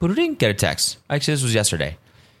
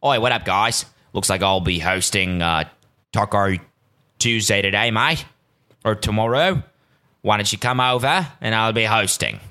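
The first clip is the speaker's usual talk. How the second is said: casual Australian mate, usual pitch; passable Australian accent. Acted. Australian friend texting an invite to Taco Tuesday, imitated Australian accent. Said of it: "it was better than the last try".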